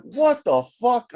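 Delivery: high, drawn-out exasperated exclamation; retold mad outburst. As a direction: act out getting mad, stretching it out. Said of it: "I'm getting mad".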